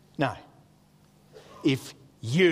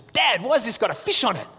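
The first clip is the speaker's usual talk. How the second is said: put-on higher, animated young son's question; acted child; 6 semitones higher than usual. Son questioning dad about his new Bible, animated and high. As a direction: play the son demanding an explanation.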